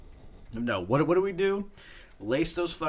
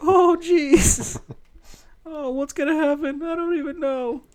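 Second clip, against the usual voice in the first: much higher, louder squeaky cartoon voice; shrieking falsetto alarm; Mister Poopy Butthole; 8 dB louder than usual. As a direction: yelp it like a panicked cartoon sidekick. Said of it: "I sound like Mister Poopy Butthole".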